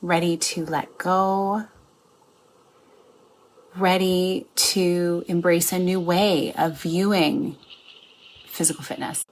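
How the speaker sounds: slow meditation guide with long pauses; guided meditation. Acted. Coach guiding listeners into a meditation, guided meditation. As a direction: ease listeners in slowly, phrase by phrase.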